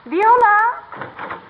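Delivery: high sing-song call across the house. Acted. Wife calling for the maid across the house, high calling voice. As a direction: call her from the kitchen.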